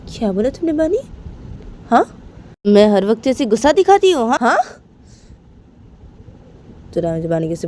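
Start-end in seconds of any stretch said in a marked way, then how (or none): 0.1-4.6 high, loud indignant challenge; indignant outburst. Acted. Rama snapping back at Bani, loud and indignant. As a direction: bristle at the accusation.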